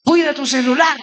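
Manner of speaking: mock warning shout. Friends warning him repeatedly.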